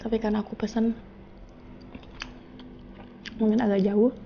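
eating mid-sentence; talking while chewing. Real, not acted. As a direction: mouth partly full of bread. Seen 1: Puts bread in mouth repeatedly; chews between phrases throughout.

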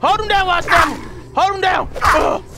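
high, loud frantic commands; shouting orders. Real, not acted. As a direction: yell at the dog to pin him. Yelling orders to dog.

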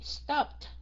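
STOPPED as "stopt" with a released T. Shown as a demonstration. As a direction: finish Stopped on a crisp PT. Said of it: "mình sẽ phát âm thành chữ T". (Stopped, đuôi ed đọc thành T)

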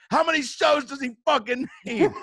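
high, loud mock outrage; acted exasperated yell; livid. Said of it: "I was livid".

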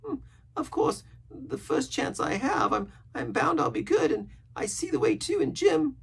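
very high, earnest sailor voice; pious rambling castaway. A narrator's reading. Castaway telling Jim of his pious youth, earnest.

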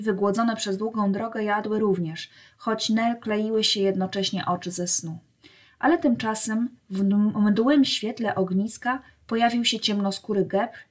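usual speaking voice

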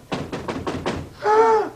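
high, sudden intake of breath; alarmed gasp. Acted. Worried about telling Missus Mule, alarmed gasp. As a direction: gasp with dread.

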